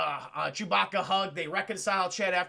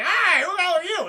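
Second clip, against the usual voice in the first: mock shout; 10 semitones higher, 9 dB louder than usual. Vader shouting at Luke.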